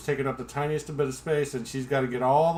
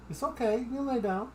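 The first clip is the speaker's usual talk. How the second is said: soothing dog talk; 10 semitones higher than usual. Real, not acted. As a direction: settle the dog gently.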